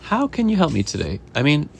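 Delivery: lilting storybook greeting; welcoming chef. Acted. Chef greeting a woman and her chicken, lightly acted welcome.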